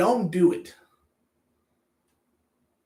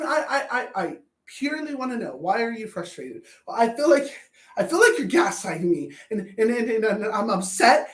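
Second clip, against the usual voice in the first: normal talking voice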